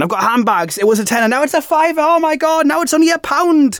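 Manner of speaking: high, excited TV sales pitch; shopping channel presenter. Retold or imitated. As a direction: hype up the bargains.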